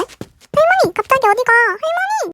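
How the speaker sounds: put-on high child's voice calling grandma. Little boy calling his wandering grandmother, high and anxious. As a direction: call after grandma like a small kid.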